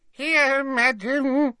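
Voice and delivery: high squeaky little elf. A narrator's reading. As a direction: squeak it like a tiny servant. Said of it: "squeaked the house elf".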